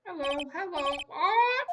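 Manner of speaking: high, slow parrot squawk; shrieking pet parrot. Acted. Parrot voiced.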